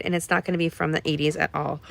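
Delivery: ordinary delivery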